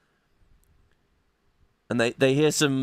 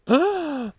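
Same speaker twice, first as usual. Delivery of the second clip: sharp high gasp; 13 semitones higher than usual. Acted. Startled by the ghost.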